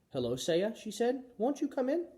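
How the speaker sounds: high, careful welcoming voice; polite hostess. A narrator's reading. Mother practising a door greeting, high and polite.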